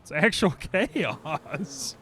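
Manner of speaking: loud, high, drawn-out words broken by laughter; laughing disbelief. Real, not acted. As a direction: laugh helplessly through the words. Laughing at the mess in game.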